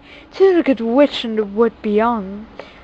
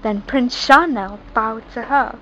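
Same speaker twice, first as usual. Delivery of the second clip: plain reading voice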